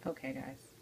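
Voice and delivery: ordinary talk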